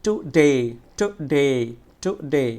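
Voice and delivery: Today with short "tu", strong long "de"; stressed, long syllable. Shown as a demonstration. Teacher drilling students, forceful long "de" in today. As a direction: hit and hold the second syllable of today. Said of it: "জোরে এবং দীর্ঘ উচ্চারণ হবে".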